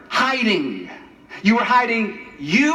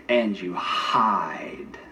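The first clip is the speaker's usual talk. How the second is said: whispered, low and very slow; 12 semitones lower, 4 dB quieter, much slower than usual. Real, not acted. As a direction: drop to a hush on the last words. Sermon's quiet climax.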